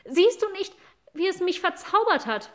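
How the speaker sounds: put-on high desperate boy's voice; a small bewitched boy begging; boy pleading. Boy begs the cat to see his spell, high pleading voice. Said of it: "sagte der Junge".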